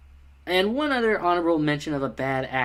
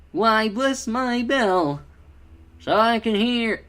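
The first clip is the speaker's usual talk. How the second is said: higher, emotive character voice with a Southern touch; Toby; 7 semitones higher, 3 dB louder, slower than usual. Retold or imitated. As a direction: lift the pitch and stretch it with some feeling. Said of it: "any sense of emotion or any kind of pitch".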